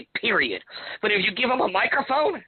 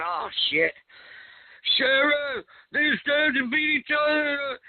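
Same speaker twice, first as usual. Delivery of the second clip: mock [name] voice; 7 semitones higher, much slower than usual. [name] voiced in retold scene.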